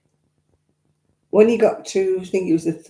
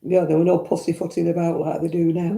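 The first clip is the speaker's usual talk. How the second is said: ordinary delivery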